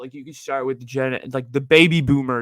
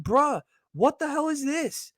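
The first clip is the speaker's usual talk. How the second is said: incredulous; 11 semitones higher, slower than usual. Retold or imitated. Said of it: "I was like".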